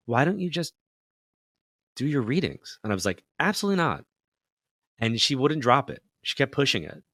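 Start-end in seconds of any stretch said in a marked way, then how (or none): 0.1-2.8 higher, lightly voiced wife; a hint of the wife nudging him; wife urging him to do readings, lightly voiced higher; wife's suggestion. 3.4-4.1 high, firm no; refusing his wife's suggestion outright, pitched high; emphatic refusal. Retold or imitated. His flat refusal to wife.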